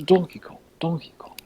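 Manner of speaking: put-on high-pitched British accent; Christopher Nolan, British accent. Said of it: "He's just British".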